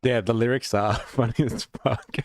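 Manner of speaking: cracking up at the lyrics; laughing. Real, not acted. Streamer laughing at a song's lyrics to chat, laughing.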